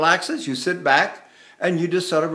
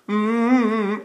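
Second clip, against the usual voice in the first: pressed-lip "mm", pitched higher; lip clamp; 5 semitones higher than usual. Shown as a demonstration. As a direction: press the lips into a held "mm". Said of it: "it's a lip clamp".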